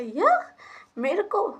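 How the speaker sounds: high, startled "me?"; can't believe she picked you; high-pitched surprised question; surprised disbelief. Retold or imitated. Told she must host the guest.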